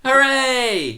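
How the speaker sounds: high, loud hooray; a real burst of joy; cheer. Real, not acted. Birthday stream celebration cheer.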